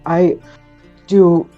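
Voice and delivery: usual speaking voice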